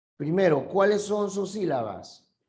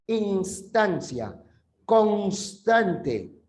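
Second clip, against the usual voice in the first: slow example word; 3 semitones higher, much slower than usual. Shown as a demonstration. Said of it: "lo encontramos aquí".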